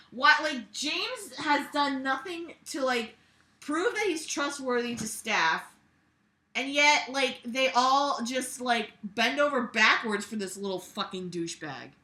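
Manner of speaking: high, loud exasperated rant. Real, not acted. Ranting about staff letting James off.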